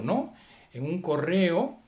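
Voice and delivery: ordinary delivery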